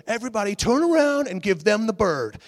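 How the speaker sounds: high, loud stage order; rally the crowd like a singer on stage; mock rocker command. Singer ordering the crowd against the praying group, mock commanding.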